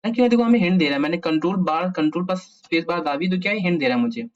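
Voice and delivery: usual speaking voice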